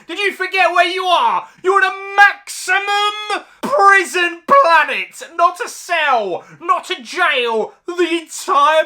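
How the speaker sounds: loud, high, fast disbelieving rant; incredulous yell. Real, not acted. Reviewer yelling at the film's absurd prison planet, loud incredulous rant. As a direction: yell it like the plot insults you. Ranting at absurd movie premise.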